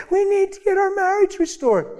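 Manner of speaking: very high, exaggerated mock whine; insecure crying plea. Husband pleading to his wife, imitated insecure crying. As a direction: mimic a tearful, needy plea in a high voice. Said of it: "'cause I cried in insecurity and said".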